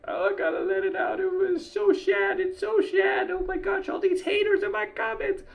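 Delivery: high, whiny mock sobbing voice; mock an oversensitive crybaby; mocking weepy whiner. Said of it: "make me feel like".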